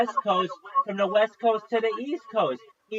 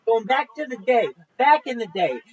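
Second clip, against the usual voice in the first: ordinary talk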